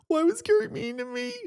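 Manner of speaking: high whiny mock self-pity; the hurt question before he calms himself. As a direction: pitch it up and whine like a hurt kid. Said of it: "talk myself off the ledge".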